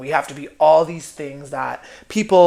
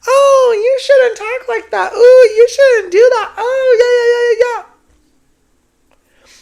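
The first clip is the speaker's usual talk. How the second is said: very high, loud, slow falsetto mockery; hammed-up squealing scold that trails into babble; cunty imitation; 8 dB louder, slower than usual. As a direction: mimic a catty nag in a piercing squeal.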